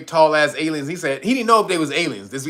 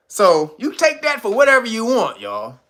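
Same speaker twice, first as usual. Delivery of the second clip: usual speaking voice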